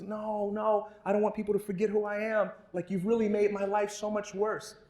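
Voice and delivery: put-on high child voice, fast and pleading; upset kid. Kid begins to cry.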